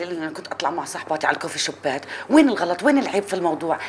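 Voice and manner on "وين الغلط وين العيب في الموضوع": raised voice. Acted. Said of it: "وطي صوتك!".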